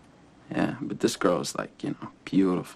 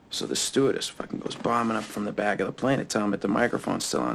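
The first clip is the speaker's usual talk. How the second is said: ordinary talk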